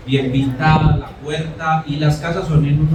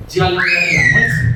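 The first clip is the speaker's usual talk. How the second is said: calling out; 3 semitones higher than usual. Retold or imitated. Sister announces lunch, voiced loudly.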